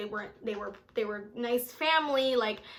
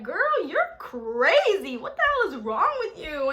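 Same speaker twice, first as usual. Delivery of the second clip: put-on slow, louder girl you're crazy; scolding mom; 6 semitones higher, 6 dB louder, slower than usual. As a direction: drag it out like a fed-up mother.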